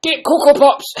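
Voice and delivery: huge high Scottish yell; shouted command. Pretend. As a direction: bellow it at full blast. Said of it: "Jesus, don't shout".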